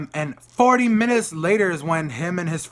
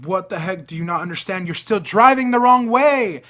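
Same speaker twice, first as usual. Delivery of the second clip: exasperated yell; 3 semitones higher, 8 dB louder than usual. Retold or imitated. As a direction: build from indignant to shouting. Retold yelling at driver going wrong.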